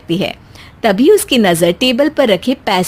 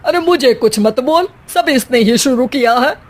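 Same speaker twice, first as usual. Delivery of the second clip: ordinary talk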